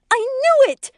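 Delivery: very high, vindicated yell. A narrator's reading. Lori believing the affair is confirmed, high-pitched yell. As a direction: yell it as an accusation. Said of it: "she yells".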